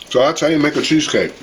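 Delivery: words muffled by a mouth full. Real, not acted. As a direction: talk around a bite of food. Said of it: "I'm talking with my mouth full".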